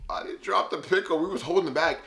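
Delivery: high-pitched retold protest; defensive denial. Storyteller blamed for dropped pickles, mock indignant defence. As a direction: deny it, voice jumping up.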